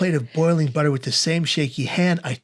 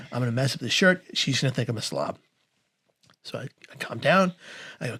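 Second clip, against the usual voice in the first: normal talking voice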